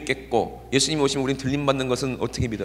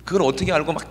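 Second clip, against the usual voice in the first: normal talking voice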